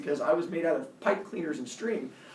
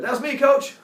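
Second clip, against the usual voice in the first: loud, much higher, eager claim; eager shout; 11 semitones higher, 10 dB louder than usual. Retold or imitated. Teammate yelling to the coach to steal credit, loud and eager. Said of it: "Rob ran right in front of me and goes".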